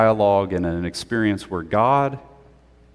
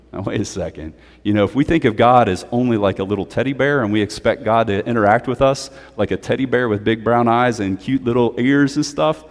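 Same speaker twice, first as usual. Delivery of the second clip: usual speaking voice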